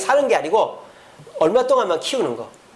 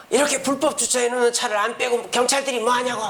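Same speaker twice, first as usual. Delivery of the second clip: mock drunken complaint; 6 semitones higher than usual. Drunk youth yelling at police.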